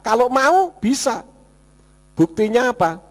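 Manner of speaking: usual speaking voice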